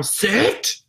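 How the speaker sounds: a brief mock yell; yelled command. Shown as a demonstration. Trainer showing what not to yell during a movie, mock yell. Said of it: "I can't yell".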